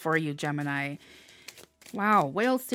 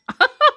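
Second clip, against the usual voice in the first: a short laugh, loud and high; delighted laugh; 10 dB louder than usual. Real, not acted. Reader laughs with delight at the career card, loud high laugh.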